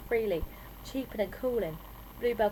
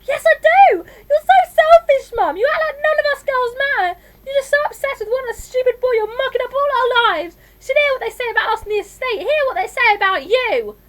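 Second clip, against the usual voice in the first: screaming high and very loud; furious tirade; 15 dB louder than usual. A narrator's reading. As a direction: let rip at Mum in a shrieking rant. Daughter screams at her mother.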